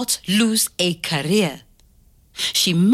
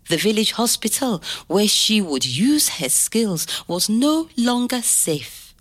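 usual speaking voice

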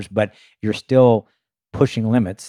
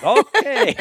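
very high, drawn-out acceptance; taken aback. Retold or imitated. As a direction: squeak it out, comically taken aback. Startled reply to radio order.

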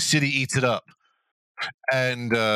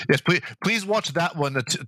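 usual speaking voice

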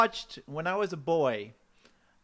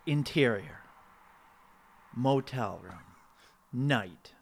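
screenplay reading; 3 semitones lower, slower than usual. Acted. Screenplay slug line.

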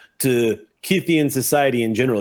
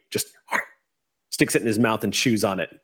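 ordinary talk

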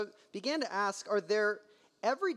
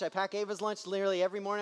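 ordinary delivery

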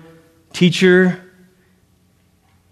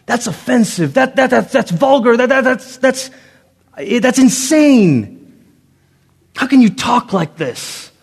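higher, sputtering indignation; mock outrage; 8 semitones higher than usual. Preacher voicing offended hearers, higher and sputtering. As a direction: act out offended listeners, appalled. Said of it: "ready to throw stones".

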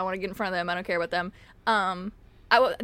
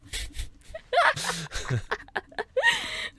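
long laugh. Real, not acted. Laughs at bad dice roll.

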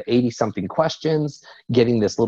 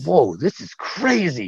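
higher, slower amazed exclamation, lightly retold; retold excitement; 5 semitones higher, slower than usual. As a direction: recalls the moment with wide-eyed awe. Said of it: "I just got really excited".

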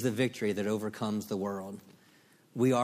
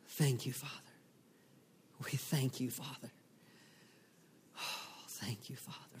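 soft whispered thanks to God; whispered prayer; 2 semitones higher, 7 dB quieter than usual. Real, not acted.

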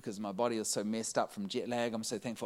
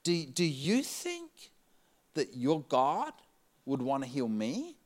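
hesitant hopeful question; 3 semitones higher, slower than usual. Retold or imitated. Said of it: "she goes".

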